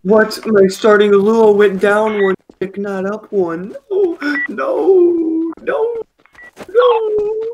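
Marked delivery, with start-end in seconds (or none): 3.9-7.4 high, cracking cries of no; anguished no. Real, not acted. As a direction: wail it in real despair. Despairs at lost game point.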